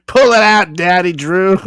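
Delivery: high, loud hammed-up come-on; mock seductive. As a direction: ham it up, begging. Playing the addict.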